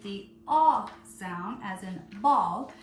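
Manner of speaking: slow, loud, drawn-out aw in ball; stretched aw. Shown as a demonstration. Teacher stressing aw for young spellers. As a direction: stretch the aw so kids hear it. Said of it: "anytime you hear me say a word with".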